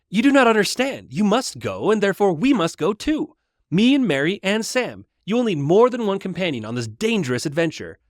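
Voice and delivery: can't wait to deliver the news; eager young Pippin. Acted. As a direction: Pippin chiming in eagerly to Frodo, young hobbit voice. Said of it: "leave Pippin sounding younger than the other hobbits".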